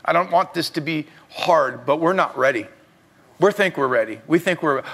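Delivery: ordinary talk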